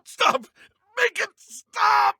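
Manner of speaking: high panicked shout to stop the pain. Acted. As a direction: beg at the top of the voice. Enemy under attack, begging.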